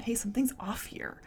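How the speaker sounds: soft unvoiced whisper. Host whispering what her inner voice says. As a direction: whisper it like a quiet inner alarm.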